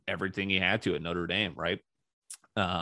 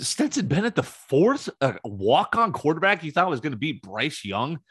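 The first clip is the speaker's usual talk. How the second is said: incredulous mockery; 7 semitones higher, 5 dB louder than usual. Real, not acted. Mocking Georgia's quarterback hype.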